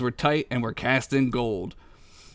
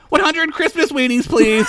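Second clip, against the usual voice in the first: high, loud eager shout; excited shout; 13 semitones higher, 6 dB louder than usual. Acted. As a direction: blurt it out like an excited kid. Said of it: "Vlog shouted".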